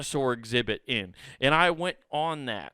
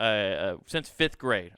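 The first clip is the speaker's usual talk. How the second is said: ordinary talk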